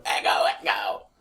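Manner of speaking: whispered alien cry with an echo. Shown as a demonstration. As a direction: call it out like Echo Echo. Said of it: "the Echo Echo voice".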